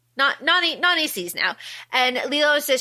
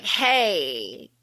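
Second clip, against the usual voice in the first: lower, softer mock alien greeting; a light creature voice saying hi; lightly voiced alien; 5 semitones lower, 3 dB quieter than usual. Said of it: "in alien language".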